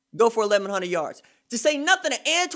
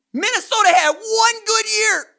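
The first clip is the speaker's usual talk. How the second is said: louder, higher, leaning hard on "one"; stress on one; 10 semitones higher, 6 dB louder than usual. Real, not acted. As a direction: hit "one" as a dismissive punch. Host mocks Minnesota fans.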